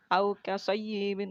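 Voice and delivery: au in kasayyibin glided gently and slowly; soft lin sound. Shown as a demonstration. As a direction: ease the vowel out, no force. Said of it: "lembut tanpa paksaan".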